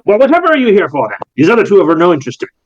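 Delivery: high, fast and haughty; brushing off the player's refusal; dismissive eccentric breeder. Acted. GM voicing Callus.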